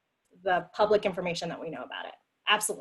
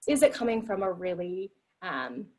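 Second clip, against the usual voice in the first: ordinary talk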